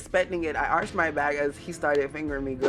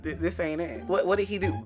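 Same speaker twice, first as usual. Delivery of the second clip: ordinary delivery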